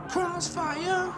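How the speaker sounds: surprised high squeal. Real, not acted. Surprised by the game name.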